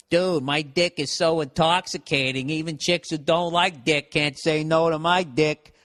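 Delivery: swaggering mock bro talk; bragging bro. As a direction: brag it out like a cocky dude. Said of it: "you can be like".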